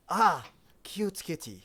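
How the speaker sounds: rough friendly brute; half orc voice. Acted. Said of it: "the half orc goes".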